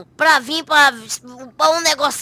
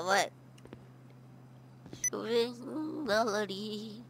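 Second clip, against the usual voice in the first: sneaky quiet muttering; 4 semitones lower, 12 dB quieter than usual. Acted. Sneakily searching the policeman.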